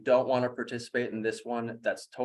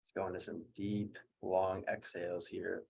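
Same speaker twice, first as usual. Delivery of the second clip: softer, settled guiding voice; quiet, level coaching through recovery; guided breathwork; 6 dB quieter than usual. Real, not acted. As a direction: talk them gently down after the breathing. Guides recovery after second round.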